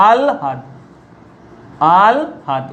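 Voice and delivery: আলহাদ said as al-had, l before h; teacher says আলহাদ as alhad; pronunciation demo. Shown as a demonstration. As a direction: model আলহাদ with the l first. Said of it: "উচ্চারণের সময় কি লিখবো?".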